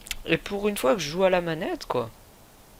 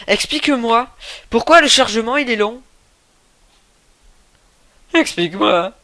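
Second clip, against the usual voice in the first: mock demand; 7 semitones higher, 6 dB louder than usual. Demands explanation repeatedly.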